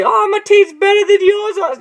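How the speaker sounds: high, snooty mock brag; mocking boast. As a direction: sneer it like a smug rival. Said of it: "And you can't say".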